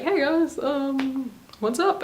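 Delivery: ordinary talk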